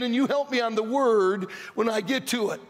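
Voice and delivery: normal talking voice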